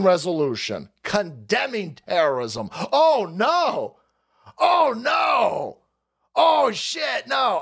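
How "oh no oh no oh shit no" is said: mock alarm. Vlogger sarcastically mourns stalled Congress, fake shouting. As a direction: cry out in sarcastic horror.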